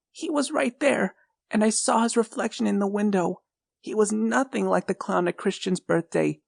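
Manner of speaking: put-on high child's voice, frightened and pleading; a small boy telling his mom about the clown; scared little boy. A narrator's reading.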